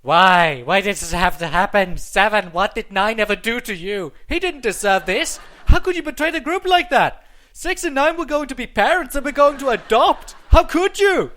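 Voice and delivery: very loud, high hammed-up wailing; hammed-up anguished outcry at the traitor Seven; melodramatic grief. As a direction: overact tragic anguish. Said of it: "invested in their struggles".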